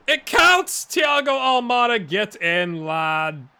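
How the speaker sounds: loud, high shout of joy at the goal; goal celebration. Real, not acted. Gamer celebrating Almada's goal to viewers, shouting. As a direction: yell it like your striker just scored.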